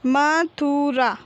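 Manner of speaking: slow, stretched म, थु, रा, falling at the end; drawn-out teaching reading of Mathura; syllable by syllable. Shown as a demonstration. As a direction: sound out Mathura piece by piece. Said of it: "तो हम इस प्रकार पढ़ेंगे".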